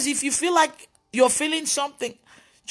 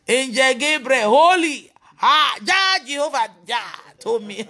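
slow, swelling mock-pious cry; drawn-out mock reverence rising to a shout; mocking imitation; 3 dB louder, slower than usual. Mocks false claims, escalating.

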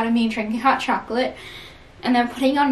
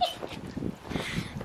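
breathless giggle; 13 dB quieter than usual. Real, not acted. Laughing in the snow.

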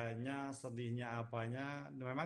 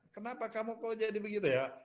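much higher and fast, surprised question; high, quick, expressive reproach; 9 semitones higher, faster than usual. Shown as a demonstration. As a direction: throw the question up high with feeling. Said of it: "pakai ekspresi kalau bahasa Inggris".

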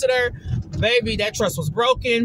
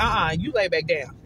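lower, understated imitation of his order; mild firm correction; 6 semitones lower, 3 dB quieter than usual. Woman imitating her date telling her to lie back, mildly firm. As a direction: gently put her in check. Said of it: "He didn't say it like that, but he was like".

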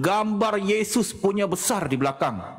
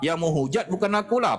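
ordinary talk

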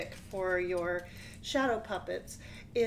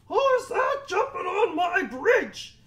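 loud, higher troll roar; 10 semitones higher, 9 dB louder than usual. Acted. Troll challenges the biggest goat, roaring loud. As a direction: roar the challenge from under the bridge.

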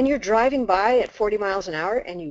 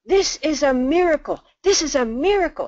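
overjoyed; 7 semitones higher, 4 dB louder than usual. Retold or imitated. Man overjoyed at finding her.

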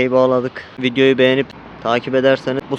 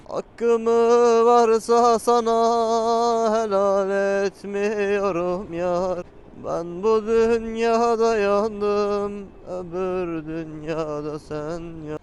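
dramatic recitation; 4 semitones higher, much slower than usual. Acted.

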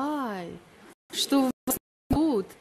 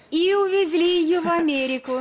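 teasing exclamation; 8 semitones higher, 5 dB louder than usual. Real, not acted. Host's teasing punchline.

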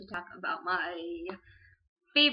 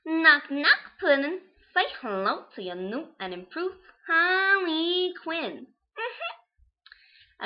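high, loud Brooklyn-style Harley voice; perky, teasing villainess voice; Harley Quinn voice; 8 semitones higher, 8 dB louder, much slower than usual. Shown as a demonstration. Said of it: "do my little voice accent for you guys".

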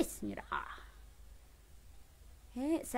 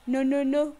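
loud cry climbing higher and higher; worked-up pleading; 8 semitones higher, 8 dB louder than usual. Real, not acted. Streamer begging her audience to stop the attacker, worked-up plea. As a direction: beg loudly as disaster closes in. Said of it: "Me, me emocioné".

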